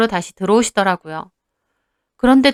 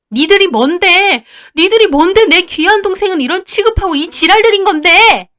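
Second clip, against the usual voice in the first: high, loud tirade, building higher; screaming with rage; 7 semitones higher, 5 dB louder than usual. Acted. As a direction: lay into the in-laws. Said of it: "언니가 악을 써대자". (악을 쓰며 퍼붓는 언니)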